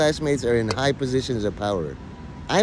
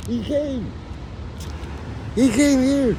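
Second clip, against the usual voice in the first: squeaky excited; 13 semitones higher, slower than usual. Pretend. Excited retelling of summon.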